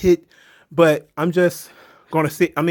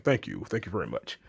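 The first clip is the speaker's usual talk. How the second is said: low, soft mock tough-guy thanks; lower, softer lightly voiced wrestler hero; Rip; 9 semitones lower, 11 dB quieter than usual. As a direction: drop way down and murmur it like a gruff hero. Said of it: "He's like".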